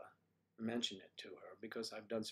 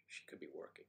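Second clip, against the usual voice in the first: ordinary talk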